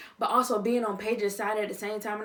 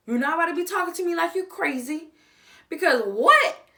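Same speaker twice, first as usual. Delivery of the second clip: raised voice, standing her ground; indignant Paige; 4 semitones higher, 5 dB louder than usual. Acted. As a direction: tell him off, punching the key words. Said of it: "I feel like I'm Paige".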